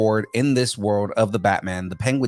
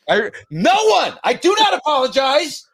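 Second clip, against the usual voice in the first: way up high, mock-prissy; high haughty voice; 5 dB louder than usual. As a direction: squeak it out all haughty.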